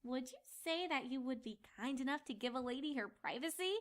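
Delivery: soft, low sly request; knowing. Acted. Twilight slyly asking the narrator, soft and low. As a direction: tease him with a knowing tone. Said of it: "she asked knowingly".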